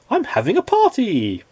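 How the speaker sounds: high cheerful call inviting him in; cheerful cry. Acted. Miss Fun calls him in to her party, high and cheerful. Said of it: "she cried".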